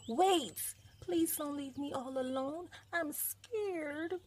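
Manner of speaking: high, pleading little cub voice; scared cry. A narrator's reading. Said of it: "cried the cub".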